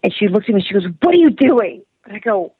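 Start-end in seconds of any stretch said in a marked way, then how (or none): 1.0-1.8 loud, high indignant outburst; retold angry yell at a stranger; imitated yelling. Angry woman confronts a stranger buying her coffee, yelling. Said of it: "She starts yelling at me".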